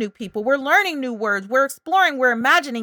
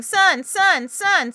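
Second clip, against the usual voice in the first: a little one fixated on one word; child repeating; 7 semitones higher, much slower than usual. Shown as a demonstration. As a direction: chant it over and over like an insistent toddler.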